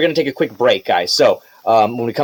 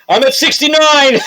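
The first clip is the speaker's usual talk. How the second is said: high, laughing mock yell; hammed-up yell, much higher, breaking into laughter; 10 semitones higher, 4 dB louder than usual. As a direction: shout it down to someone far below. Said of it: "as you yell down to her".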